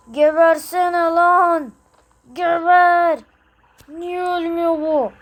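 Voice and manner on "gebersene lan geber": slow, loud, higher "die" yell; angry yell. Acted. Player screaming at the enemy to die, angry yell. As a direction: roar it at the enemy.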